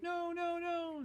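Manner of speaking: very high, soft squealed no's; desperate friends. Acted. Friends dragged away from the lady, very high and soft. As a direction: cry out helplessly. Friends protest being kicked out.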